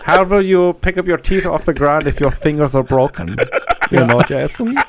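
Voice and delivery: put-on polite threat in a German accent; hammed-up German menace. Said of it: "did you interrogate people in the army?".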